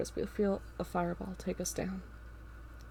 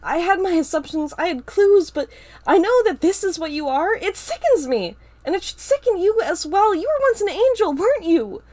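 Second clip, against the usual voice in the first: outraged accusation; 10 semitones higher, 11 dB louder than usual. Acted. Star confronts Ava, outraged.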